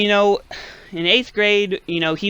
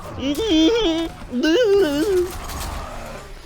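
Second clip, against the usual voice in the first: laugh; 13 semitones higher than usual. Real, not acted. Laughing at own impression.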